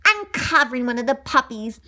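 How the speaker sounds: plain reading voice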